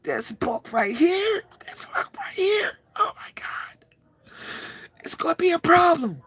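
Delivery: high, breathless, tearful whisper; real emotional, voice giving way; overcome with excitement. Real, not acted. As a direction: fade into a choked, awed whisper. Overwhelmed showing the book.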